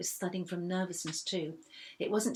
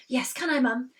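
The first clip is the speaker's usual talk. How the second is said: eager; 6 semitones higher, 8 dB louder than usual. A narrator's reading. Poppy begging.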